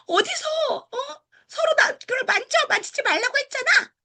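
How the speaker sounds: very high, cracking scold; shrill angry scolding. Retold or imitated. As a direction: shriek at the kids in disgust. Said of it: "막 이런 식으로 표현하신 거예요". (아주 높고 날카롭게 꾸짖듯)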